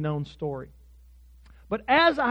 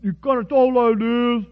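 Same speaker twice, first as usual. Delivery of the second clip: louder, higher put-on coach accent; Butch's funny accent; 5 semitones higher, 8 dB louder than usual. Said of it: "So he had this funny accent".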